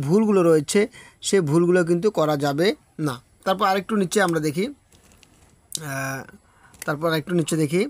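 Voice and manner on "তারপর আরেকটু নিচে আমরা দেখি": usual speaking voice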